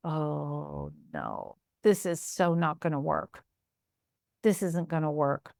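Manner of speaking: dismayed thought, quiet and deflated. Retold or imitated. Dismay after first week.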